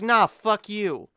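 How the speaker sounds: imagined comeback to an insult, loud and slow; mock retort. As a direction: snap it back loud and slow. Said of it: "and be like".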